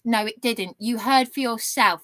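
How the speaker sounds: ordinary talk, nothing marked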